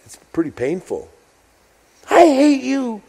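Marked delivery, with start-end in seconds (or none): 2.1-3.0 high mock child yell, I hate you; a pouting kid shouting at a parent; kid's outburst. Parent recalls child yelling at them, high and mock. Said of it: "have you ever had your kid tell you that".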